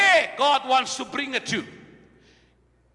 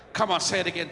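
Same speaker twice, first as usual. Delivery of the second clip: ordinary delivery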